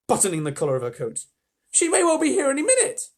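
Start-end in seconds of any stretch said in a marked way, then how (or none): 1.7-3.1 put-on worried girl. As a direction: urge them anxiously. Susan worried.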